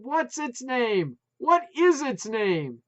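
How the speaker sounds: loud, slow, high; slow yell. A narrator's reading. Putz yelling the question slowly over dogs, loud. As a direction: spell each word out. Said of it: "Putz yelled".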